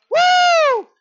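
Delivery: very high, very loud "woo"; high holler of release; exultant whoop. Real, not acted. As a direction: let out a piercing preacher's whoop. Whoop at the sermon's peak.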